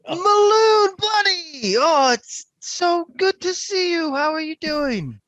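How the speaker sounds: sarcastic over-the-top welcome, slow on the middle; overjoyed greeting. Acted. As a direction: lay the fake friendliness on thick. [name] fake-greets Maloon.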